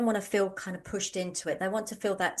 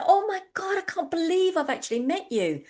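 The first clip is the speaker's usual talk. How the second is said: higher, thrilled gush; gushing fan; 11 semitones higher than usual. Retold or imitated. Fan meeting the poster she follows, high gushing. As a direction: gush like a starstruck fan meeting her idol. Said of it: "she said".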